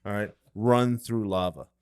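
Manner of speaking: low, quiet mock drill-sergeant self-talk; a hint of a hardcore motivational coach; mildly imitated self-hype on the drive home; Goggins pep talk. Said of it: "I recited Goggins all the way home".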